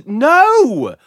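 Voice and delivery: high, loud indignant no; loud refusal. Real, not acted. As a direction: shout it down in disbelief. Rejecting pub plans.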